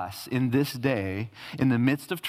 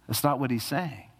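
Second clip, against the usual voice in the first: ordinary talk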